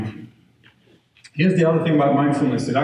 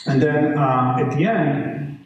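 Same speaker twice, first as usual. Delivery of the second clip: ordinary delivery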